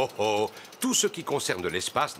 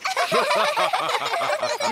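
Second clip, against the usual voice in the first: laugh. Acted. Laughs at his own joke.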